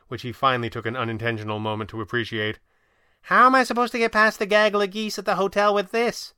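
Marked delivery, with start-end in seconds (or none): 3.2-6.3 high, loud, exasperated heroine; exasperated Kit. A narrator's reading. Kit complains to the Red Panda about her clothes, exasperated.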